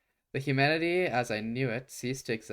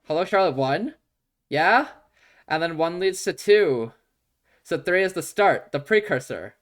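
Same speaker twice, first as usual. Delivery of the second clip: loud, higher, eager theorizing; excited; 4 semitones higher, 9 dB louder than usual. Real, not acted. Streamer excitedly piecing the timelines together for chat, loud and eager. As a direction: rattle off the theory with glee.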